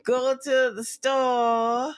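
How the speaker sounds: very slow Louisiana drawl; Louisiana accent. Shown as a demonstration. Sending babe to the store, slow Louisiana drawl. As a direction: drag every vowel, Louisiana style. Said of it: "It's very slow in Louisiana".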